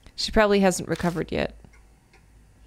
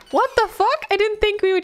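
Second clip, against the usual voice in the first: much higher, stunned disbelief; shocked; 14 semitones higher than usual. Real, not acted. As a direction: blurt it out in shock. Shocked reaction to rescue.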